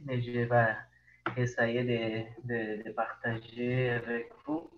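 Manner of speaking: Brazilian Portuguese accent in French; French spoken with a Brazilian accent; foreign accent in french. Real, not acted. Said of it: "mon, mon français".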